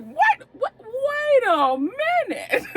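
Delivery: high, slow, stunned double take; mock disbelief, voice way up; surprised. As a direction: react like you just can't believe it. Retold reaction to movie twist.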